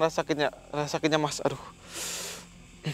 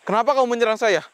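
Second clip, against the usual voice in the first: higher, louder challenge; confronting demand; 8 semitones higher, 5 dB louder, faster than usual. Real, not acted. Ghost hunter confronting the attacking spirit, high loud demand.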